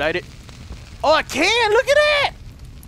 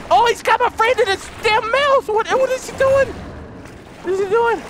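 alarmed; 12 semitones higher than usual. Real, not acted. Alarmed at tiger.

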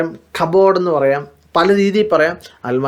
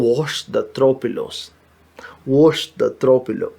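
slow model phrase; 2 semitones lower, 3 dB quieter, much slower than usual. Shown as a demonstration. Teacher modelling a new word slowly for students. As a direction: stretch out each word of declutter the closet. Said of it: "throw pillow എന്നാണ് പറയുന്നത്".

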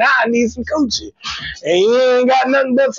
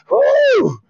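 high pained ooh; 14 semitones higher than usual. Retold or imitated. Burning in the shower.

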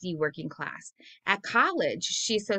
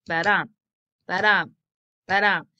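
thump out each "ba-dum" like a pulse; heartbeat ba-dum; 7 dB louder than usual. Shown as a demonstration. Teacher imitating a heartbeat to show iambic stress. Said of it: "like the heartbeat".

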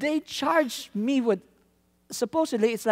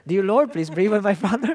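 prayer; 6 dB louder than usual. Retold or imitated. Praying for taxi driver.

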